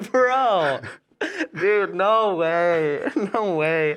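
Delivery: loud, high, drawn-out incredulity; real stunned reaction, stretching the words slowly; laughing refusal to believe it; amused disbelief. Real, not acted. Listener's disbelief at anticlimactic punchline.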